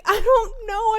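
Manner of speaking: high, loud mock protest; hammed-up helpless protest. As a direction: exclaim it high, playing up exasperation. Acted quarrel over the accent.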